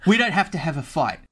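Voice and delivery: a touch of nervous bargaining; lightly voiced elf guard, placating. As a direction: lightly act an elf talking his way out. Elf pleads not to fight.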